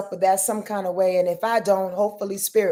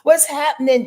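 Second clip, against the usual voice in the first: ordinary talk, nothing marked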